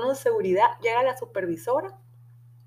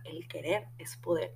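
whispered mantra; 3 semitones lower, 8 dB quieter than usual. Retold or imitated. Frase dicha como mantra secreto.

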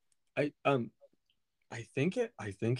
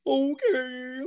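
squeaky high greeting; high put-on okay; high chirpy okay; 10 semitones higher, 6 dB louder than usual. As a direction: pitch it way up playfully. Mock high greeting on phone.

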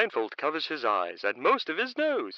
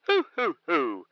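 owl hoot chuckle; 2 semitones higher, 3 dB louder than usual. Acted.

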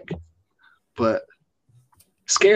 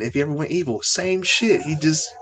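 normal talking voice

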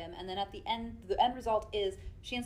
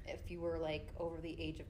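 quiet whisper; 4 semitones lower, 8 dB quieter than usual. Real, not acted. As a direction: keep it hushed. Whispered continuation.

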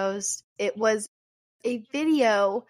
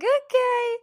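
very high, louder squeaky reply; bashful mock acceptance; 12 semitones higher, 4 dB louder than usual. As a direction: accept it in a tiny high put-on voice. Same bashful reply acted again.

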